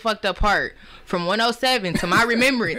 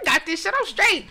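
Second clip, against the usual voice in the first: higher, cocky I-got-this voice; tipsy bravado; 8 semitones higher than usual. Retold or imitated. Retelling her birthday night to a friend, high cocky tipsy bravado. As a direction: brag like you're sure you're fine.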